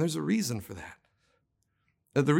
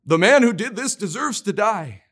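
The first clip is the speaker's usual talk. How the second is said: loud, furious verdict; loud, angry king condemning the man; exploding outburst; 4 semitones higher, 6 dB louder than usual. A narrator's reading. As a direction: spit out a death sentence in rage. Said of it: "He explodes".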